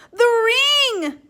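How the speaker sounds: high, loud startled cry; near scream. A narrator's reading. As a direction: burst out at the sight of the ring. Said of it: "Maria nearly screamed".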